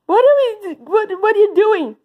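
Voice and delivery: high, stumbling pretend panic; alarmed protest. Summoned bully to teacher, alarmed protest. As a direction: play a kid caught out, stammering.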